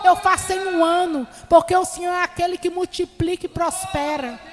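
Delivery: steady, earnest sermon voice; preaching. Acted. Preacher exhorting the brethren about family and prayer, earnest sermon voice. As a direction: exhort the flock to make time.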